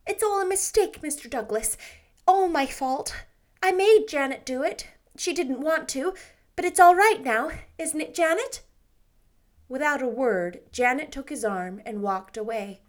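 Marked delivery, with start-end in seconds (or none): 0.1-8.6 lightly voiced anxious young woman; Anne, flustered girl. A narrator's reading. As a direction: hurry to mend it. Anne explains, pleading.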